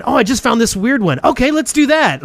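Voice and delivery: high-pitched, eager mock quote; excited discovery. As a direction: imitate getting carried away by a find. Acting out impulsive group decision.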